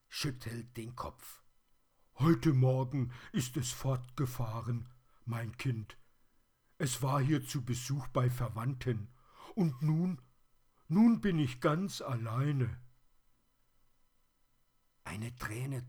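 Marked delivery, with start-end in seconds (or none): 2.2-12.8 high, loud, lamenting toy voice; raised, woeful teddy character; forlorn plush teddy. A narrator's reading.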